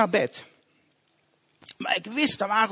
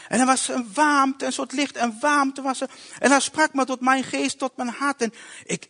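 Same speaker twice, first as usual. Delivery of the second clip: ordinary delivery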